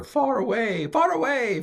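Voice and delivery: playful high sing-out of distance; far-off calling. Shown as a demonstration. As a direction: stretch it out as if into the distance. Acts out distance from accent.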